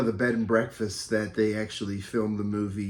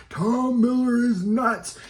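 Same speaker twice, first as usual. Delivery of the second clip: much higher, very slow, eerie ghostly wail; 13 semitones higher, 4 dB louder, slower than usual. Pretend. Speaking into Tom's tape recorder at the bridge, ghostly wail. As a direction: moan it like a spirit on the tape. Replaying the prank voice.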